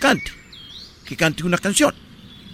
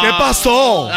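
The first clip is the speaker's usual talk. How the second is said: louder, higher melodramatic demand; dramatic confrontation; 4 semitones higher, 4 dB louder, slower than usual. Acted. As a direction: confront him like a telenovela. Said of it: "imagínate que le dijera así".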